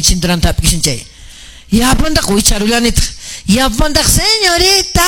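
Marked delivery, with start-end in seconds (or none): 4.0-5.0 high, drawn-out plea; desperate call. Retold or imitated. Worker in pain calls the señorita, desperate. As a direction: call for help, stretching the word out high.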